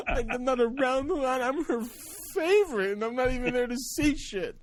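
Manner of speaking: very high, hammed-up tearful wail; mock-sob the guilt in a squeaky cry; imitating blubbering. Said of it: "I'm a blubbering mess".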